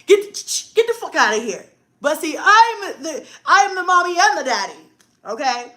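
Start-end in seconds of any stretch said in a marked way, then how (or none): 0.1-1.6 loud, raised acted order; imagined husband's warning. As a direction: act out running someone off. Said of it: "That would be a shotgun moment".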